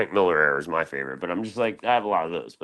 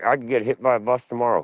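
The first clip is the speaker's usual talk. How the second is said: ordinary talk, nothing marked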